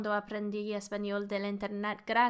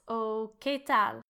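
normal talking voice

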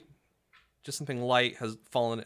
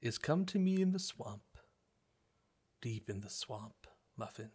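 creepy luring voice; 5 dB quieter than usual. Acted. GM voices tempter to Muffin.

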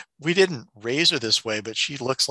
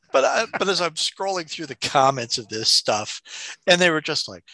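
ordinary talk, nothing marked

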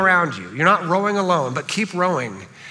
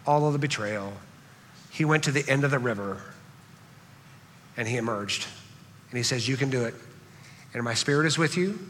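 gentle preaching; 4 semitones lower, 6 dB quieter than usual. Acted. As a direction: ease down and comfort the congregation. Softer, slower sermon close.

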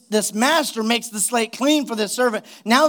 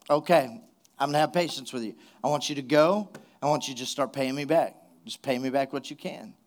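low, quiet imagined reply; hushed, understated patience; calm, lenient master; 7 semitones lower, 6 dB quieter than usual. Acted. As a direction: speak softly and low, letting the debtor off gently.

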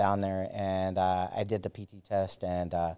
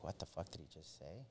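barely voiced, much softer, stunned; a stunned soldier hearing a slur, muttering; shocked under-breath mutter; 4 semitones lower, 23 dB quieter than usual. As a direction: mutter it to yourself in disbelief. Retold shock at racist remark.

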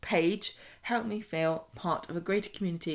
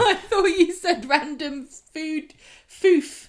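laughing; 9 semitones higher, 7 dB louder, much slower than usual. Real, not acted. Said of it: "Not foodstuffs".

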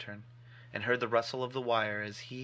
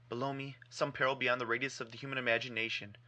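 usual speaking voice

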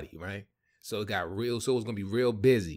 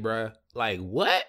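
ordinary talk

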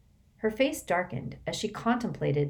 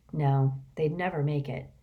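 ordinary delivery, nothing marked

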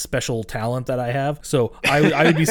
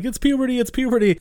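much higher, repeating it in mock panic; panicky mock Miles; 12 semitones higher, 4 dB louder than usual. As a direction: squeak it out like a nervous teen. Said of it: "He keeps saying".

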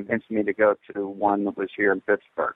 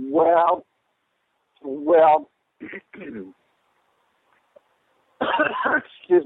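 choking, coughing through words; strained after coughing fit; swallowed wrong way; much slower than usual. Real, not acted. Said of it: "something went down the wrong pipe".

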